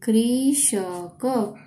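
कृषक drawn out very slowly, rising; sounding out slowly. Shown as a demonstration. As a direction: stretch कृषक syllable by syllable.

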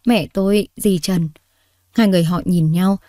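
usual speaking voice

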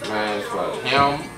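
usual speaking voice

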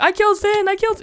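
high mock gloating voice; gloating chatter. Gamer mimicking chat users gloating at killing him. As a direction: brag like a smug chat troll. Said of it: "people in chat like that who are like".

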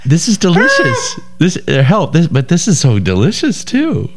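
squeaky mock delight; high, squeaky silly voice. Mock voice after laughing.

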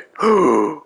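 sudden high gasp; sharp, loud intake of breath; startled gasp. Real, not acted. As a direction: gasp in alarm. Startled during escape.

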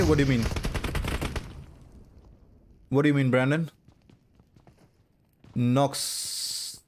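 normal talking voice

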